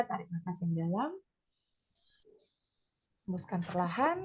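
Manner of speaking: hushed instructor guiding each breath; guided breathing. Shown as a demonstration. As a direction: gently lead a breathing exercise, softly. Leads listeners through slow deep breaths.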